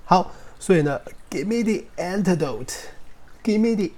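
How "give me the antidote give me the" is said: mock desperate plea. Shown as a demonstration. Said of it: "为什么这边念the".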